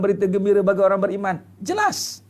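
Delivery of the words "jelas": high exclaim. Real, not acted. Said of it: "emphatic exclamation".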